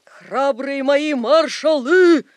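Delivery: high, loud, slow stage call; commander's cry. A narrator's reading. Stage Napoleon hails his brave marshals, commander's cry. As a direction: hail your troops grandly, stretching the words. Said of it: "крикнул Наполеон".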